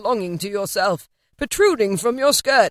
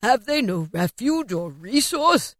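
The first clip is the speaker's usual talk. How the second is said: anxious cry; 3 semitones higher than usual. A narrator's reading. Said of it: "cried Scrooge".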